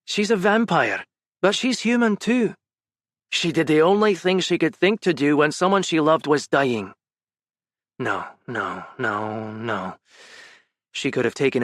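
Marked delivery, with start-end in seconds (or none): 0.1-6.9 remembered voice. Retold or imitated. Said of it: "replaying Gavin's words in his mind". 8.0-10.0 soft, low, muttered no's; quiet denial. Acted. Ben rejecting Gavin's words, quiet denial.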